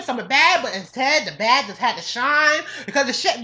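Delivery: usual speaking voice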